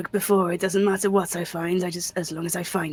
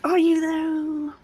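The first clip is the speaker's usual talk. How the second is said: teasing; 10 semitones higher, much slower than usual. Real, not acted. Teasing the scary cat.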